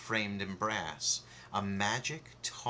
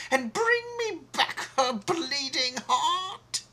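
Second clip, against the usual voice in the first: much higher and loud, barking orders; furious shout; 5 dB louder than usual. Acted. Queen ordering the huntsman to kill the girl, shouting. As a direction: rage at the huntsman. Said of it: "She shouted at him".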